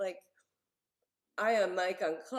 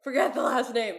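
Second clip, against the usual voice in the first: loud, laughing retort through giggles; retelling her laughing answer to her dad; 6 semitones higher, 10 dB louder than usual. Retold or imitated. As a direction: laugh while brushing it aside. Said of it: "I laughed and I said".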